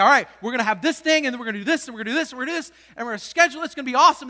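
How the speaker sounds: loud, eager scheduling dad. Retold or imitated. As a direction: rattle off the vacation plan excitedly. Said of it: "I'm like".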